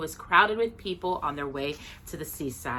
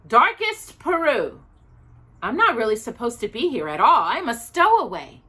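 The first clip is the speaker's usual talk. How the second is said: higher, louder confiding bear; lightly voiced bear; 5 semitones higher, 5 dB louder than usual. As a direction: bear owns up to stowing away. Bear explains his origin.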